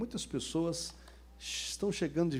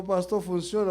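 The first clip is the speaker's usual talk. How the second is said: loud, raised-pitch asking; imitated eager question; 5 semitones higher, 8 dB louder than usual. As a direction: play a man loudly checking with his pastor. Imitates man eagerly questioning pastor.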